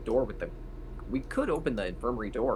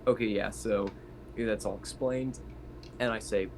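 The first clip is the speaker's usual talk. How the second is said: ordinary delivery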